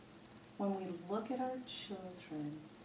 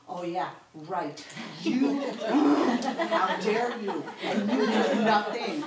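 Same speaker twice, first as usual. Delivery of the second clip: loud, laughing mock outrage, slowed down; laughing sarcasm; 3 semitones higher, 10 dB louder, slower than usual. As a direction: laugh through a sarcastic how-dare-you. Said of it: "in my mind, I'm thinking".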